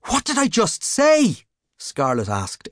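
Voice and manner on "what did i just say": high, slow exasperated rebuke; fed up with him. A narrator's reading.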